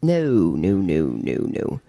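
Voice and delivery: string of no's sliding steeply down in pitch; drawn-out no tumbling into a low run of no's; mock refusal, sinking to the bottom of the voice; refusing. Retold refusal of the prayer's answer.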